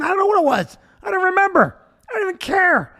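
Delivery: high, emphatic comic exasperation; worked-up, impatient disclaimer; exasperated dismissal. Real, not acted. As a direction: brush it off, pitch riding high. Comic rant dismissing the cause.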